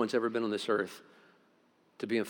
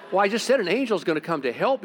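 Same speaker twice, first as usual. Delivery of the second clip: protesting; 9 semitones higher, 7 dB louder than usual. Retold or imitated. Defending himself to his wife.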